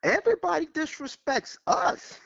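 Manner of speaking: high, indignant complaint. Real, not acted. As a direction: vent the grievance. Caller venting indignation.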